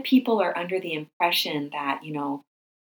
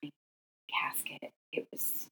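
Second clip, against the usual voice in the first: barely voiced, fading to a breath; choked-up whisper; 4 semitones lower, 8 dB quieter than usual. Real, not acted. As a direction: whisper it as grief takes your voice. Remembering the tiny casket carried.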